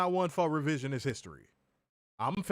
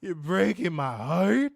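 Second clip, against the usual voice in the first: melodramatic lover; 5 semitones higher, 8 dB louder, slower than usual. Pretend. Mock soap breakup scene.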